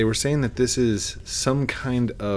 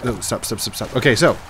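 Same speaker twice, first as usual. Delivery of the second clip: normal talking voice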